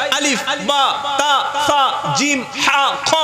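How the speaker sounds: slow, deliberate আলিফ, বা, তা, each letter said on its own; reciting the alphabet. Shown as a demonstration. Preacher teaches the congregation the Arabic letters, slow and deliberate. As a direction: spell out the Arabic letters one by one. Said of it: "আরবি ঊনত্রিশটা অক্ষর আছে".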